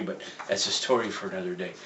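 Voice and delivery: ordinary delivery, nothing marked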